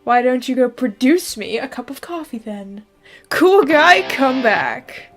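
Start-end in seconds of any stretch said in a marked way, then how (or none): none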